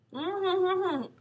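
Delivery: gagged humming; muffled. A narrator's reading. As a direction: hum through a gag. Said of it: "Don't speak with your mouth full".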